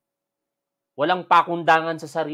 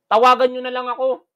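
raised, high-pitched challenge; calling out; 7 semitones higher, 5 dB louder than usual. Real, not acted. Host dares his online critics to call him, raised challenge. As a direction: throw out a loud, high dare.

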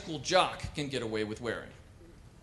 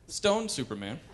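ordinary talk, nothing marked

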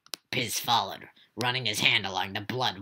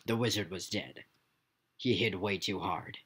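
ordinary delivery, nothing marked

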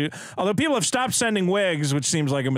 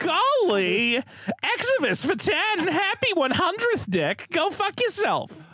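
high, whiny put-on voice; 13 semitones higher than usual. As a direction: whine it out, camp. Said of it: "Didn't he have kinda like a whiny voice?".

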